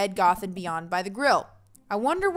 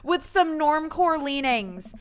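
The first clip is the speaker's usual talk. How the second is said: put-on high, loud teenage yell; Amanda's raised, shouted reply; yelling back; 7 semitones higher, 6 dB louder than usual. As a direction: call the answer back across a distance. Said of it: "Amanda yells back".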